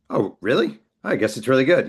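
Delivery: mock surprised. Author answering a friend's praise, mock surprised. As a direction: act amazed it could be good. Mock self-doubting reply.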